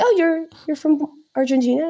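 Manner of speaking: high, amazed local asking Oh, you're from Argentina; surprised question. Retold or imitated. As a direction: imitate a local's delighted surprise. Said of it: "And they'll be like".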